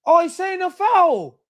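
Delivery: high, slow, drawn-out; stunned disbelief. Real, not acted. Fan hearing the ref's call, high and drawn out. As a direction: stretch it out in disbelief.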